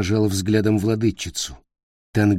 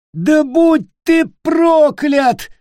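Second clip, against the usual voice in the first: very high, slow, loud curse; damn him with everything left; furious curse; 9 dB louder, much slower than usual. Acted. Nick cursing Peter for refusing. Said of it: "закричал Ник".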